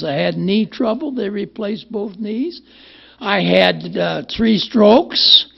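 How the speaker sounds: a light impression of an old man listing his troubles; imitating Bill D. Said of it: "he used to talk like this".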